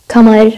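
كمر with k for the qaf; dialect variant. Shown as a demonstration. Teacher showing a dialect, كمر with a k sound. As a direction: say كمر starting on a plain k. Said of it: "هاي حسب اللهجات".

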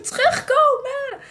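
long, high drawn-out yell; shouting come back. Retold or imitated. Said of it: "Dus ik heel hard roepen".

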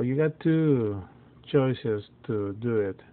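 usual speaking voice

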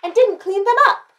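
high, loud outraged question; storybook mom's scandalized query; indignant mother. A narrator's reading. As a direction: pile on in a high voice. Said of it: "asked Missus Chan".

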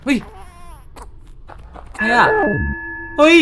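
startled yelp. Real, not acted. Startled by new character.

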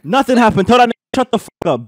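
loud, high-pitched shout back; angry yelling. Real, not acted. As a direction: yell it, irritated, to shut someone up. Yelling back at someone interrupting.

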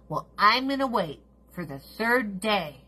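lightly mocking dawdler voice; a hint of a mocking lazy voice; mock procrastinator. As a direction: parody someone who always waits. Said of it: "I'm like".

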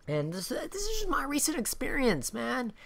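normal talking voice

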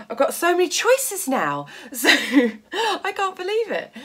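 high, giddy disbelief through laughter; excited laughing. Real, not acted. So many choices of what to make, excited laughing. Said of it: "getting all excited".

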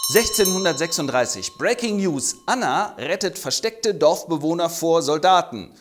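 hammed-up news anchor; breaking news flash. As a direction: sell it like a breaking headline.

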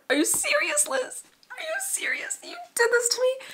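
very high-pitched squealed disbelief; outraged fan squeal. Acted. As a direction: squeal at the author as if betrayed. Mock outrage at the author.

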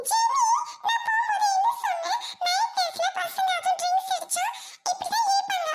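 high-pitched, insistent reproach; exasperated scolding. Acted. Friend cross with the crying girl, scolding.

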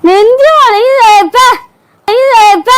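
high, loud, hammed-up cry: நெஞ்சு வலிக்குது wailed; loud wail of pain. Wife escalates fake chest pain. (உரத்த நாடகத்தனமான வலி ஓலம்)

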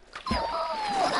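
high, sudden gasp; sharp gasp. Real, not acted. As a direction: gasp in surprise.

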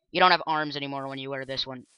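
ordinary talk

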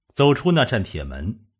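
plain reading voice

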